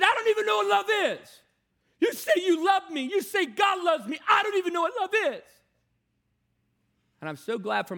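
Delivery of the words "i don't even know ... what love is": high, loud, raw outburst; angry teen shouting. Retold or imitated. Rebellious teen shouting at his mentor, angry and hurt. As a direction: hurl it back like a hurt teenager.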